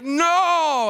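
loud, high no; upset no. Retold or imitated. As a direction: cry out in dismay. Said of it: "I'd just be like".

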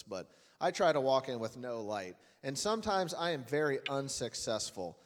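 ordinary talk, nothing marked

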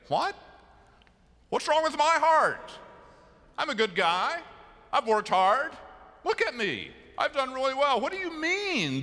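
high, loud, indignant protest; indignant older brothers. Acted. Older brothers protesting to Samuel, high and indignant. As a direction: voice the offended big brothers pleading their case.

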